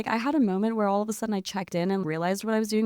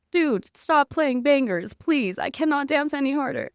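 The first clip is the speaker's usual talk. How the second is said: higher, pleading, slowed mock complaint; mock exhausted plea; 6 semitones higher than usual. Retelling to a friend, pleading with the DJ in mock exhaustion. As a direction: beg the DJ to stop, half joking. Begging the DJ to stop.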